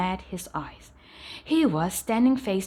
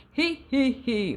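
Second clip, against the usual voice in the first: higher wheezy he he he; wheezing laugh; 5 semitones higher than usual. A narrator's reading. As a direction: laugh out of breath, giggling. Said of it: "wheezed the dragon".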